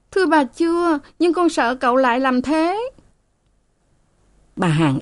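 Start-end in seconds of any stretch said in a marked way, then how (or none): 0.1-2.9 put-on high, pleading young maid. A narrator's reading. Frightened maid begs her mistress to save her, high and pleading. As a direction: beg the mistress for help in a high voice.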